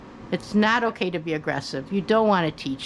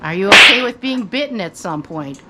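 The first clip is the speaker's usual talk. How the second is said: emphatic question; 9 dB louder than usual. Real, not acted. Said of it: "pointed question to viewers".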